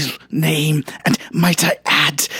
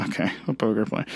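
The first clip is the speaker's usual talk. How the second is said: deadpan disbelief; 8 semitones lower, 10 dB quieter, much faster than usual. Acted. Dry disbelieving repeat.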